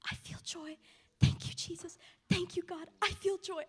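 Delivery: high, breathless exclamations quoting her; overflowing joy. Retold or imitated. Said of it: "this joy coming out of her".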